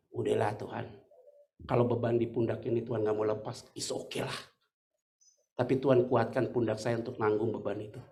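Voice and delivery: low, soft retelling of a prayer; subdued, low plea to God; resigned prayer. Retold or imitated. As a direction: quietly give in to God, voice dropped.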